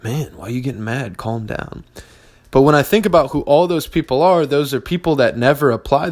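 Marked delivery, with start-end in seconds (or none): 0.0-1.8 mock calm-down voice. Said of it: "who'd be like".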